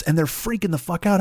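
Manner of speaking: normal talking voice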